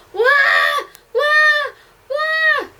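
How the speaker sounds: very high, loud wah cries; shrieking wah after wah; bad-tempered screaming. A narrator's reading. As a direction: scream like a child in a tantrum. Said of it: "bad-tempered screaming and sobbing".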